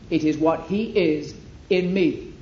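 usual speaking voice

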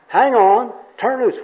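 loud, high confused echo; acted exasperated confusion; baffled repeating. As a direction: throw the muddled advice back loudly. Confused bum repeats worker's advice.

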